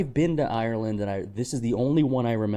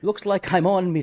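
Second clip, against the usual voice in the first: Irish leprechaun; 9 semitones higher, 4 dB louder than usual. Pretend.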